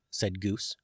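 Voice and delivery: usual speaking voice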